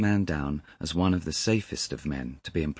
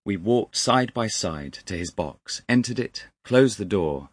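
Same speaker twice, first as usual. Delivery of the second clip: usual speaking voice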